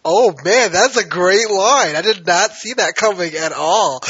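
wow, loud and delighted, savouring it; amazed delight. Retold or imitated. Fan reacting to a great TV line, loud and amazed. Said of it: "And I was like".

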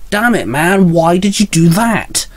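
high-pitched appalled outburst; blurted out. A narrator's reading. Spider-Man confronts One Up over the kill, blurted outburst. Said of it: "Spider-Man blurted".

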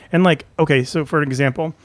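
usual speaking voice

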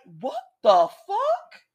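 high, drawn-out disbelief; retold shock. Rider retelling a fast slide, stunned disbelief. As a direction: stretch it slowly upward in mock shock.

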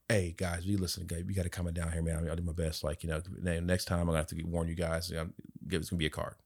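soft, low, reasonable voice; calm referee. Pretend. How the ref should have spoken to parents, soft and calm. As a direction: talk them down gently. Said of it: "What he should have done is been like".

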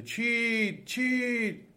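high, drawn cry of the trees. A narrator's reading. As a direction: call it out high, like trees raising the alarm. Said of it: "فریاد زدند".

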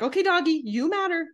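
high, sing-song placating tone; mock soothing to a dog. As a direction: coo at the dog to calm it down. Soothing dog while escaping.

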